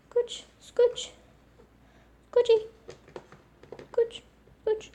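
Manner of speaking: put-on high squeaky baby-talk coaxing. Nudging her animal over in a tiny squeaky voice.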